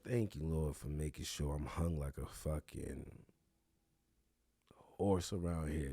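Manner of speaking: low, subdued mock thanks to the Lord; mock prayer. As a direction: murmur a low, joking prayer.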